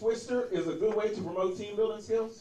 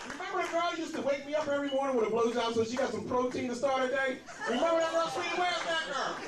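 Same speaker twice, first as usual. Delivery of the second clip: higher, sing-song mock sweetness on "sweetie"; mocking mimicry; 7 semitones higher than usual. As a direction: throw her words back in a syrupy, mocking voice. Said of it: "I was like".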